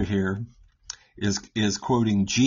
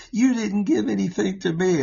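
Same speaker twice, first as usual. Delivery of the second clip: put-on whiny kid; 8 semitones higher than usual. Child complaining about a gift.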